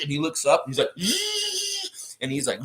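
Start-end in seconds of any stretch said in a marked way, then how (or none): 1.0-2.2 very high sustained squeal; long high-pitched "zzz"; imitated transformation noise. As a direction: make a piercing power-up whine.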